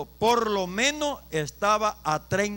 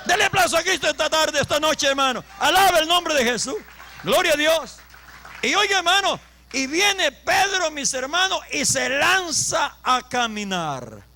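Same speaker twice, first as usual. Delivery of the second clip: loud, raised preacher's call; full-force preaching; 7 semitones higher, 5 dB louder than usual. Real, not acted. Preacher urging brothers to praise Jesus, full-force preaching. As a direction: rouse the congregation to applaud.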